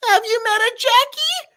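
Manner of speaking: very high fussy lecturing; Paper's voice. Acted. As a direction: nitpick in a squeaky voice. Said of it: "Paper and Knife talking".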